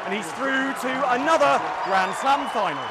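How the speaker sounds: loud, high, thrilled over crowd noise; excited courtside report. Real, not acted. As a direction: shout it out over a roaring crowd.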